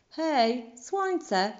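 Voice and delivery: calling up loud and high; shout. A narrator's reading. Father mouse shouting up to the sun. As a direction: call out to the sun far above. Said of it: "krzyknął tata mysz".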